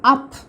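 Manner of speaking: "up" with a short "uh" vowel. Shown as a demonstration. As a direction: say "up" as a brief "uh-p". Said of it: "برضو نطقتها اه". (نطق "up" بصوت "أَه" قصير)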